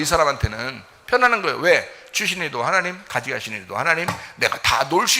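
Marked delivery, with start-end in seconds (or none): none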